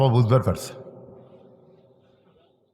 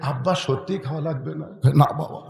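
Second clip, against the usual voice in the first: much softer, timid; meek mumble; 2 semitones lower, 7 dB quieter than usual. Retold or imitated. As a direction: mumble it afraid of the wife. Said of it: "মিন মিন করে কয়".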